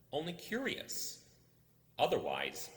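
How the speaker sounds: ordinary delivery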